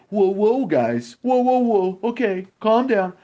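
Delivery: high, breezy mock referee; mock ref calming. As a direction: imitate a ref waving it off lightly. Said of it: "The ref would watch them do it and say".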